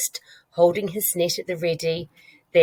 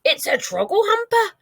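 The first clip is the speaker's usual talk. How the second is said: high giant's shout. A narrator's reading. Giant naming the nightmare to Sophie, shouting. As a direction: shout out the name in alarm. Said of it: "he shouted".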